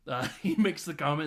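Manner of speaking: ordinary talk, nothing marked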